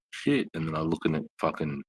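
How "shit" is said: surprised realization. Retold or imitated. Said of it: "I was like".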